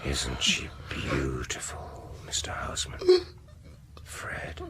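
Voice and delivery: menacing whisper. Acted. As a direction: purr it softly over the victim. Villain presents wife menacingly.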